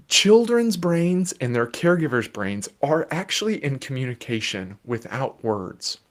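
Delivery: usual speaking voice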